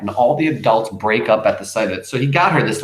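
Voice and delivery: ordinary delivery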